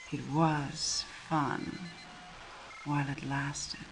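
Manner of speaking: low, soft and slow farewell; quiet parting taunt. Acted. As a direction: savour the goodbye, low and soft. Villain's parting taunt to Captain.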